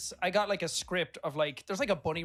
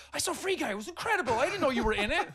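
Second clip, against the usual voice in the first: much higher and gushing; excited fan voice; 12 semitones higher, 6 dB louder than usual. Retold or imitated. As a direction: gush like a starstruck fan. Chiropractor quoted.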